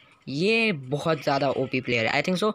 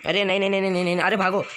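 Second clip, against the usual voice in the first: panicked shouting; 5 semitones higher, faster than usual. Real, not acted. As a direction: yell in panic as the bot charges. Bot closing in, panicking.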